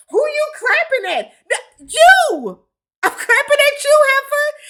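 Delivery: high, loud mock-angry retort; mad. Woman snapping back at boyfriend over clapping, mad. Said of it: "she gets mad and she's like".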